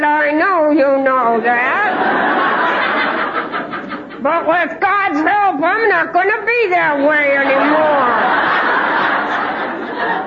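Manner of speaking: slow and high, laughing through the words; laughing promise. Retold or imitated. Wife promising her husband through laughter, slow and high. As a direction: promise it while giggling.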